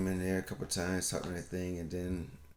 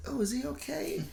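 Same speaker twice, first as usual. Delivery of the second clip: much higher, overly concerned coo; worried fussing adult. Retold or imitated. Relatives fretting over silent child.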